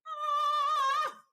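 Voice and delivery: high surprised "Ah!" of recognition; sudden high cry of recognition; delighted shriek. Acted.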